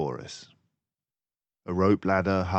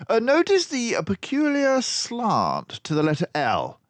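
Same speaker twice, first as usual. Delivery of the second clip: high, loud, brisk detective voice; a clever mouse sleuth, pleased with himself; Basil the mouse detective; 7 dB louder than usual. A narrator's reading. Narrator voicing Basil to Dawson, high and loud. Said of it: "he pointed out".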